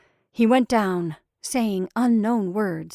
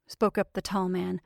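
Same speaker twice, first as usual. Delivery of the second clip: ordinary delivery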